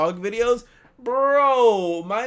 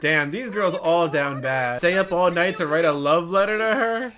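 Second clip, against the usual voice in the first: ordinary delivery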